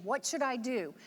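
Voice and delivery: ordinary delivery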